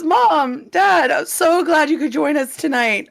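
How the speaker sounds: high-pitched, put-on delight; forced cheer. Son greets parents who crashed his night, forced cheer. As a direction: greet them too sweetly.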